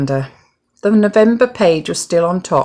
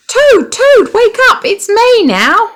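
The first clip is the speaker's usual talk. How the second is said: very high, loud excited call; joyful shout; 9 dB louder than usual. A narrator's reading. Frog excitedly waking Toad on the calendar trick. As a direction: burst in with the good news.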